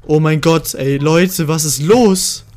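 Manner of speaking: real excitement, pushing the volume up; thrilled disbelief. Real, not acted. Player to viewers after a kill streak, thrilled.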